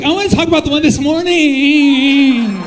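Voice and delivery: high, loud mocking whine; mock eager pleading. Mocking an audience member's request.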